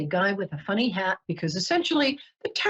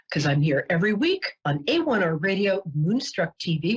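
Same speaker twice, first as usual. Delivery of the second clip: usual speaking voice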